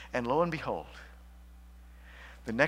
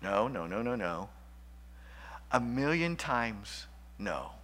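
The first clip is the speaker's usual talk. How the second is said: firm low refusal; 5 semitones lower, 7 dB quieter than usual. Real, not acted. Preacher rejecting the misreading.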